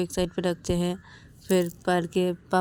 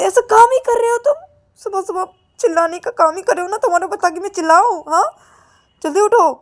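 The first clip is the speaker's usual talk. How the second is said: high, loud, fast scolding; scolding sister; 12 semitones higher, 10 dB louder than usual. Acted. Payal scolds Ananya for making her yell. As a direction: tear into her for dawdling.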